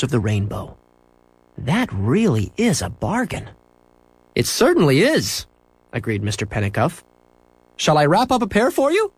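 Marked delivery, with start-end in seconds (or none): 1.6-3.5 a hint of an eager boy, slow; boy admiring the fish, lightly voiced. A narrator's reading. Boy in pet shop admiring fish, lightly voiced. 4.3-5.4 high, friendly shopkeeper; cheerful shopkeeper agreeing. A narrator's reading. Shopkeeper to boy in pet store, high and cheerful. 7.8-9.1 high, friendly shopkeeper; cheerful shopkeeper agreeing. A narrator's reading. Shopkeeper to boy in pet store, high and cheerful.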